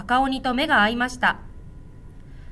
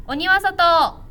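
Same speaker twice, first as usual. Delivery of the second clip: higher, louder courageous shout; brave shout; 6 semitones higher, 6 dB louder, much slower than usual. A narrator's reading. Shy boy finally shouts at the ogre, brave shout. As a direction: find your courage and yell it.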